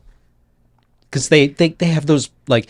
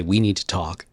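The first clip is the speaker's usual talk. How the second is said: low and soft, serious; hushed serious in-character; grave quiet line; 6 semitones lower, 6 dB quieter than usual. Acted.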